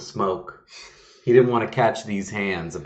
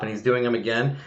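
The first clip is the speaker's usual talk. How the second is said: usual speaking voice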